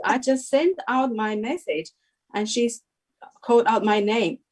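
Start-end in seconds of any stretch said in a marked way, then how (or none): none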